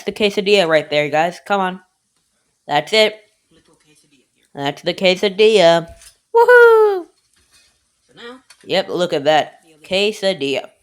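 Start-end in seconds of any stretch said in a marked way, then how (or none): 6.3-7.1 high, loud whoop of triumph; excited cheer. Real, not acted. Cook cheering his finished quesadilla, high excited whoop. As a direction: celebrate the finished dish.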